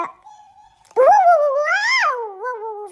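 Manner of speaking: high drawn-out startled ooh. Real, not acted. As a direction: let out slow spooked oohs. Startled by game anomaly.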